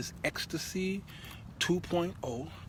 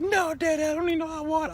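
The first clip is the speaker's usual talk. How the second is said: put-on high, loud girlish voice for his daughter; daughter's high voice; 7 dB louder, faster than usual. Father mimics his daughter brushing him off, high and girlish. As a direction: squeak it out like a teen daughter.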